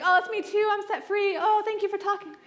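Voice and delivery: hammed-up high joyful gush; mock gushing repentance.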